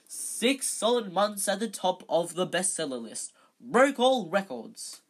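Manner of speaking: high, loud, self-satisfied brag; loud boasting. A narrator's reading. Lockhart bragging of his bestseller, loud boasting. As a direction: proclaim it with gusto.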